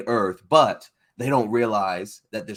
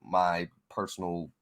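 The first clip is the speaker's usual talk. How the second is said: ordinary delivery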